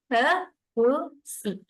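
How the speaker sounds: slow, each syllable of kerusi pulled apart. Shown as a demonstration. Teacher splitting kerusi into syllables for parents, slowly. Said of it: "one clap, one syllable".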